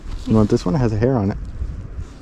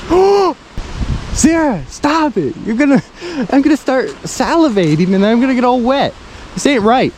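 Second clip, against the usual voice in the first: excited; 7 semitones higher than usual. Real, not acted. Chicken sandwiches make him drool.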